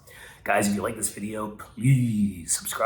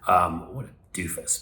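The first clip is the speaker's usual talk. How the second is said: normal talking voice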